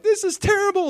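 very high-pitched mock wail; mock distress. Israel handed over to enemies, mock distress. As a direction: complain in exaggerated dismay. Retold distressed people crying to God.

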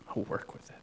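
breathy whisper as God; whispered promise from God. Acted. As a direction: let it fall to a hush. God's voice, repeated softly.

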